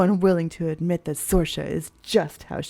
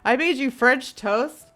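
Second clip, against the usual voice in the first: mock hurt boyfriend; 6 semitones higher than usual. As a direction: whine it like a wounded cook. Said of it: "He's just like".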